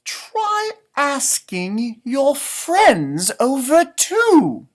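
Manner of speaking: a wise owl, slow and deliberate; owl's advice. Acted. Owl advising Rabbit, slow drawn-out voice.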